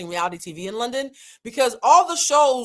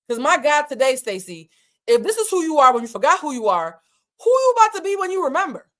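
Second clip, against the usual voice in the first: high, building retold hype; hype-up pep talk; 7 semitones higher, 5 dB louder than usual. Friend hyping Stacey up before a comeback, rousing pep talk. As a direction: pump her up like a fighter, pitch climbing. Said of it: "like, hype up Mike Tyson".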